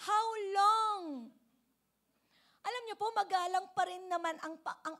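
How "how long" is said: loud, raised, drawn-out cry to God; pleading question. Acted. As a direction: call it out as an anguished question. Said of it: "tanong niya dito".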